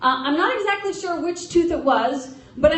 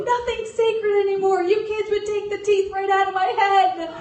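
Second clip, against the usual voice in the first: higher, scolding mother voice; angry mom; 7 semitones higher than usual. Retold or imitated. Mother accusing her kids over her teeth, mock angry. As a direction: mimic mom griping about her teeth. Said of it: "she was angry".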